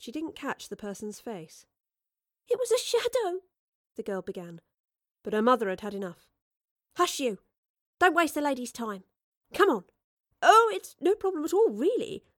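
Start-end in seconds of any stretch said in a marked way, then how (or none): 2.5-3.4 put-on scared child. A narrator's reading. Frightened girl begins. 7.0-9.8 scolding mother. A narrator's reading. Mother hushes daughter. 10.4-12.2 professor voice. A narrator's reading. Professor reassures mother.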